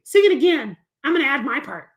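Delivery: high eager kitty; puppet cat voice. Acted. Cat puppet asks to join the song, high and eager. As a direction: ask brightly as the cat.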